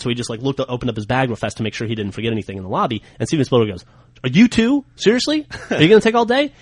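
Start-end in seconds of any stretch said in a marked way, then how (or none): 4.2-6.5 high, incredulous and impatient, pitch shooting up; mock a fed-up celebrity hurrying someone; high-pitched exasperated whine at a dawdler; exasperated impatience. Spielberg impatient at stalling guy.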